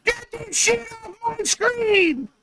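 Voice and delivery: mock screaming fan. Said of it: "screaming at the top of their lungs".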